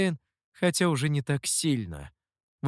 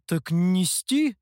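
cautious question; 4 semitones higher, much slower than usual. A narrator's reading. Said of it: "осторожно уточнил хозяин".

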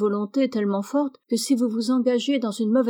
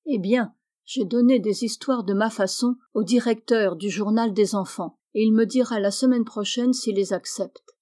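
usual speaking voice

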